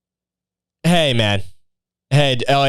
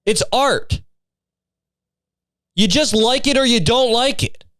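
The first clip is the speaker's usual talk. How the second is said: worked-up rant; 6 semitones higher than usual. Real, not acted. Ranting at online [name] critic.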